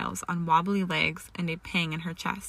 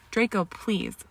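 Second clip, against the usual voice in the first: ordinary delivery, nothing marked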